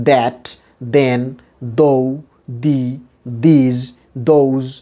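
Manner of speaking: that, then, though, the, these, those each with a hard D; common D pronunciation. Shown as a demonstration. Teacher showing what we usually say, D-sound that, then, though, the, these, those. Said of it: "যেমন আমরা বলি".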